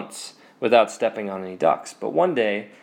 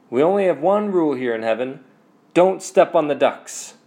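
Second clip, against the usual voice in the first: lightly acted, higher-pitched rule-giver; Saint Peter's warning; 5 semitones higher, 4 dB louder than usual. Saint Peter announcing heaven's rule.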